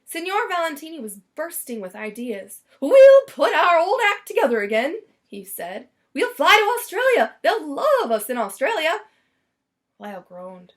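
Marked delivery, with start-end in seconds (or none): 2.8-5.0 loud, exuberant stage-star voice; bursting with big plans, projected; excited showman. Acted. Valentini pitching the old act to Lyle, loud and excited. 6.1-9.0 loud, exuberant stage-star voice; bursting with big plans, projected; excited showman. Acted. Valentini pitching the old act to Lyle, loud and excited.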